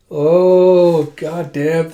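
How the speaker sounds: loud, high, drawn-out oh; thrilled reaction. Real, not acted. Reader reacting to the kiss, thrilled. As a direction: let out a big oh of delight.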